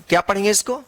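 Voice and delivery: usual speaking voice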